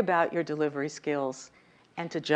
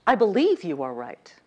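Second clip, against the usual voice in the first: believe punched, louder; stress on believe; 6 dB louder than usual. Shown as a demonstration. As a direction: lean on the word believe. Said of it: "move the emphasis along the sentence".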